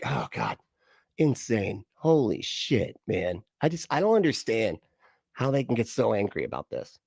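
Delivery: ordinary talk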